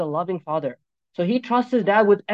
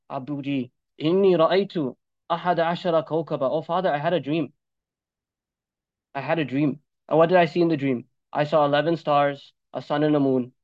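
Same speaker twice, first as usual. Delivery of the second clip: put-on trusting boy; 7 semitones lower than usual. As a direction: confide a dream gently. Young Yusuf tells his dream.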